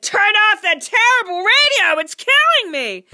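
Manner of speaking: very loud, high-pitched yell; shouted. A narrator's reading. Husband to wife about the radio, shouted. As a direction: yell it out in full agony. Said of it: "Immediately he shouted".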